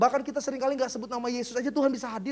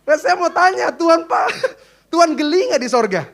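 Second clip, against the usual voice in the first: louder, laughing through the words; laughing aloud; 5 semitones higher, 7 dB louder than usual. Real, not acted. Pastor laughs while asking the congregation. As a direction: burst out laughing mid-question.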